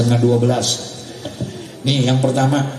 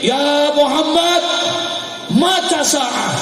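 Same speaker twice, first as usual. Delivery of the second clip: high, drawn-out calling voice; imitated yell; 12 semitones higher, slower than usual. Young man barging into the Prophet's sermon, acted high yell. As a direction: call out like the shouting young man, much higher. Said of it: "ini anak muda teriak".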